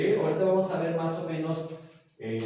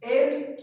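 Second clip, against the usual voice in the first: Él said high and loud, set apart; a single emphatic Él after a pause; stressed Él; 11 semitones higher, 5 dB louder than usual. Shown as a demonstration. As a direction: lift Él up and hold it out. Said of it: "la palabra él".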